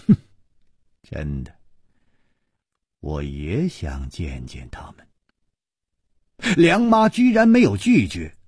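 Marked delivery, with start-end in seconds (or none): 0.1-5.1 cold sneer. A narrator's reading. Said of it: "楚留香冷笑着说".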